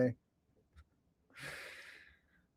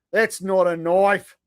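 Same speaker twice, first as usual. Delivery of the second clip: slow hammed-up Aussie, "That's not a knife" drawn out; mock Australian accent; 4 semitones higher, slower than usual. Streamer quoting Crocodile Dundee in a mock Australian accent. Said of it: "speaking your native tongue".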